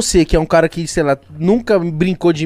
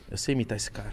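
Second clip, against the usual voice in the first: quiet boast; 9 semitones lower, 16 dB quieter than usual. Retold or imitated. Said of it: "você fala assim".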